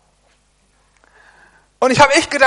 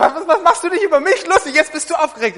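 quick, higher and louder, laughing as the wife; wife teasing, laughing; 7 semitones higher, 5 dB louder, faster than usual. Retold or imitated. Wife teasing her husband over the eggs, laughing. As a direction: tease him back through laughter. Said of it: "Und hat sie gesagt".

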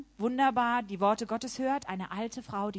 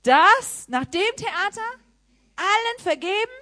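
indignant outburst; 12 semitones higher, 12 dB louder, slower than usual. Retold or imitated. Outraged at being told to forgive.

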